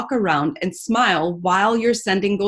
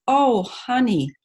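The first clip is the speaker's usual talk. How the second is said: slow Southern "Oh, honey"; Southern drawl; 3 semitones higher, slower than usual. Acted. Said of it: "I can start the Southern drawl".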